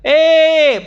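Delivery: loud high "Eh" called out; imitating a loud call of recognition; shouted call. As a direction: hail someone across the yard. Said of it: "padahal teriaknya sama-sama".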